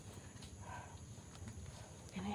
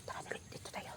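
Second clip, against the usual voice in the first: hushed urgent whisper; whispering while hiding; 14 dB quieter, faster than usual. Real, not acted. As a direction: whisper them toward cover. Hiding from someone in game.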